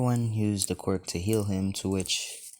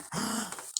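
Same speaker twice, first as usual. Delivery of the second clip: short, high intake of breath; startled gasp; 13 semitones higher, 7 dB quieter than usual. Acted. Boy suddenly recognises his doctor, startled gasp. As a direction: gasp in surprise.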